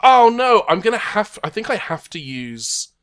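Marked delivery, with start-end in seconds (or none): 0.0-0.6 rising, loud groan of dismay. Real, not acted. Game master reacting to a bad roll, loud dismay. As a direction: lament it louder and higher as it sinks in.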